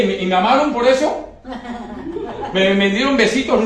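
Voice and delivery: sarcastic laughing question. Real, not acted. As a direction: chuckle through the ironic question.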